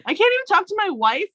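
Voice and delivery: lightly voiced indignant husband; aggrieved husband. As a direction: complain like a hurt husband. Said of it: "He's like".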